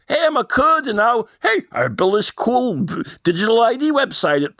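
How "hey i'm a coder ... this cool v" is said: ordinary talk, nothing marked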